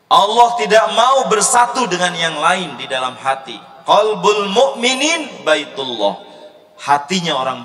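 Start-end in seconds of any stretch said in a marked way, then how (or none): 0.1-6.2 emphatic preaching. Real, not acted.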